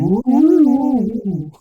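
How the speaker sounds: high, loud, eerie ghost moan; a spooky hooting ghost call; ghostly ooh. Acted. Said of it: "need to get, like, a flanger".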